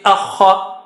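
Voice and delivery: normal talking voice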